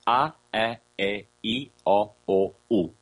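A, E, I, O, U as separate pure sounds, ah to oo; seven pure vowels. Shown as a demonstration. Teacher sounding God's name, A, E, I, O, U as pure separated vowels. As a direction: intone each of A, E, I, O, U without glides, with two E and two O sounds. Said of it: "this is the true sound of the name of God".

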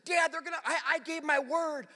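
high-pitched imitation of an upset son; mock-upset boy stumbling over his protest; flustered kid. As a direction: plead like a flustered fifth grader. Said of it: "he's just flustered".

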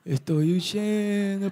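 very slow, drawn-out flight; slow motion. Shown as a demonstration. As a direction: drag out huyendo as if in slow motion. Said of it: "pero en slow motion". ("huyendo" estirado, en cámara lenta)

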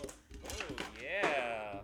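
drawn-out "oh, yeah" with a hint of mascot; lightly like a cartoon mascot's catchphrase; Kool-Aid Man. Real, not acted. Podcaster agreeing about Die Hard, sounding a bit like a mascot. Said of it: "I just sounded like the Kool-Aid Man".

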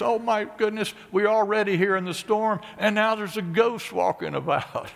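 high, loud mock panic of the disciples; frightened disciples. Preacher voicing panicked disciples in the boat, mock alarm. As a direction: play the disciples' alarm, hammed up a little.

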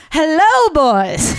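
put-on very high, loud HELLO with boys falling; imitating the coach's big, high Hello, boys with arms flung up; imitating the coach's take. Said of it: "he threw his arms up and he goes".

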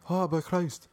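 high-pitched, hammed-up stage Irish; Tom Cruise's Irish accent. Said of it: "I can't do his accent".

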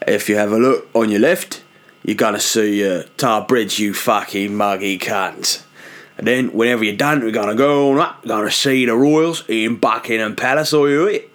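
put-on tour guide's London accent drifting into Australian; hammed-up, wandering London-Australian tour patter; botched London accent turning Australian. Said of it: "what happened to the accent there".

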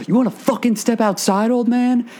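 high-pitched, confrontational husband; imitated angry challenge. Retold husband challenges the Russian guy.

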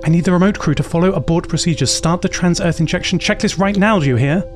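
fast, desperate and pressing; frantic urgent plea. Acted. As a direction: beg them to abort now.

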